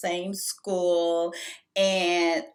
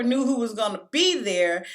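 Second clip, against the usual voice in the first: normal talking voice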